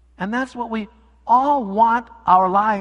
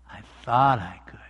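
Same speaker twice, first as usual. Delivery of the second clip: fading whisper; whispered satisfaction; 8 semitones lower than usual. Acted. Little engine going down the mountain, whispered. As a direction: breathe it out contentedly.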